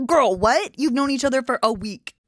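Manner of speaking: loud, high, stunned disbelief; incredulous outburst. Real, not acted. Talking to her mom about marrying after a week, loud disbelief. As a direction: shout it like you can't believe it.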